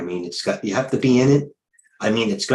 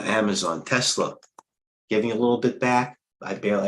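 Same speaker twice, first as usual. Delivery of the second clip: usual speaking voice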